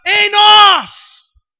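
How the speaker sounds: loud, slow, fervent proclamation; preaching shout. Acted. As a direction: preach it out to the church. Preaching at full force.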